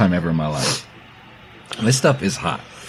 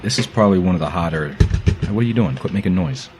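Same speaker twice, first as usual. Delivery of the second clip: ordinary talk, nothing marked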